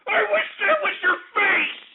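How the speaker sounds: high, crying shout; tearful rage. Real, not acted. Host threatening his hecklers, tearful rage. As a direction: scream it through furious tears.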